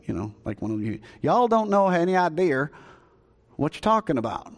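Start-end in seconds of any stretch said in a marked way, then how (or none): 1.2-4.5 put-on Jesus done in a folksy Southern accent. Pastor voicing Jesus to the Samaritans, playful Southern accent. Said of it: "If I can put it in Southern".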